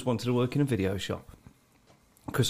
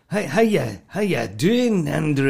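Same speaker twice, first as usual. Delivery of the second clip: loud, higher, slow hammed-up Scottish greeting; mock comedian greeting; 4 semitones higher, 4 dB louder, slower than usual. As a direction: ham it up like a comic doing a Scot. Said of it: "comedians go up to you and go".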